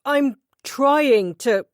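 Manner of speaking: high, loud and slow, fighting for control; strained emotional outburst. A narrator's reading. Husband pleading with his wife, strained and loud. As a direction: force it out through rising emotion. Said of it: "with anger or emotion".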